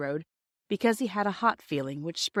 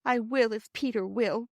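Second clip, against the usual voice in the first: sniffling; 4 semitones higher than usual. Acted.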